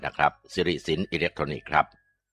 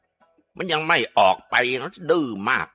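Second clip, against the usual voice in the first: angry scolding; 7 semitones higher, 3 dB louder, slower than usual. Acted. Exorcist scolding while whipping.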